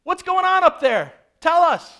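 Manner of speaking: high, loud call up to a window; crowd's shouted demand. Acted. Crowd yells up to the disciples for answers, shouted demand. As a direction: call out insistently, well above normal pitch. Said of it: "they're even saying".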